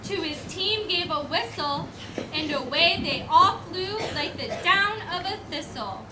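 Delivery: steady storybook recitation; poem reading. Acted. Reading the poem to the school audience, storybook recitation. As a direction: read the old Christmas poem aloud. Reads Christmas poem aloud.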